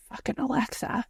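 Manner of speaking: unvoiced under-breath curse; whispered curse. Real, not acted. Muttering to her co-host about Alexa, whispered. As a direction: hiss it under your breath.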